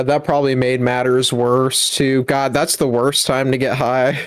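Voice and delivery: usual speaking voice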